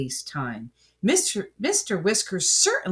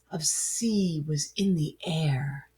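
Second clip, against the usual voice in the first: hushed whisper; 4 semitones lower, 7 dB quieter than usual. A narrator's reading. Soft atmospheric narration.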